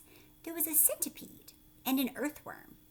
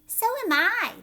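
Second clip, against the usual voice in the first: higher, louder squeal; high cry; 10 semitones higher, 10 dB louder than usual. Acted. Ladybug to the other insects, high eager cry. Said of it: "the ladybug cried".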